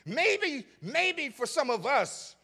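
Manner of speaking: preacher crying maybe to the church; preacher's cry. Acted. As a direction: lift it up, stretched.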